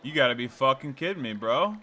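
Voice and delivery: usual speaking voice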